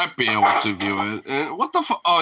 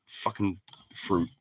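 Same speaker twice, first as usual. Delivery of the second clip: normal talking voice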